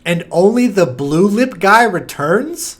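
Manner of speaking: wait, what, then build to stunned outrage; rising disbelief. Real, not acted. Sudden disbelief at sequel news.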